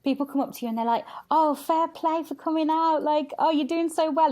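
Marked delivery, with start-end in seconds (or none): 1.3-4.3 mock patronising praise. Strangers praising her in club.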